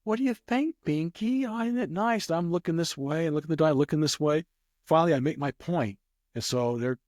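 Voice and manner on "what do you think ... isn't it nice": high, doting dog-owner voice; imitated lady. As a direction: mimic a lady chatting to her pet, high-pitched. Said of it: "She's going".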